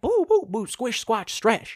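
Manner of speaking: high bouncy cartoon sounds; exaggerated animated bounce; cartoonish exaggeration. Shown as a demonstration. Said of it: "it needs to be like theater".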